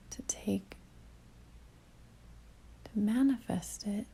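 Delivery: calm meditation delivery with long pauses; guided meditation. Real, not acted. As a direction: ease the listener inward, leaving long silences between phrases.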